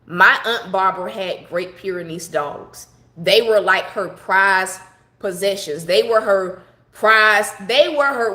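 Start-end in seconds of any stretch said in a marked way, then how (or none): 3.2-7.7 slowing right down on prized; slow emphatic. Real, not acted. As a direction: drag out her prized possessions for weight. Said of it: "when I say".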